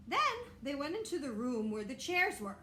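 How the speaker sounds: plain reading voice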